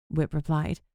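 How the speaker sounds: ordinary delivery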